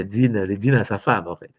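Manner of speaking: ordinary delivery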